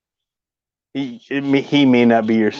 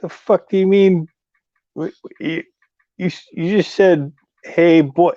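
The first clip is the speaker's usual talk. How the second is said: retold indignant disbelief; 5 semitones higher than usual. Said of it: "I looked at him funny".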